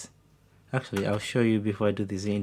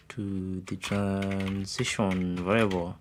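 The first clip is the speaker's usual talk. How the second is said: slow, stretched syllables at lower volume; drawn out while typing; 3 semitones lower, 4 dB quieter, slower than usual. Real, not acted. Coder narrating the transition as it is typed, drawn out. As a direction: sound it out slowly while typing.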